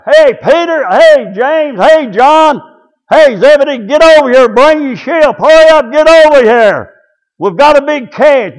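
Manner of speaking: high, loud calls across the water; hollering. Acted. Peter calling his partners to bring the ship, hollering. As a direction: holler urgently for help with the catch. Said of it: "Started hollering".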